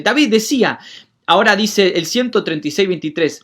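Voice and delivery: ordinary talk, nothing marked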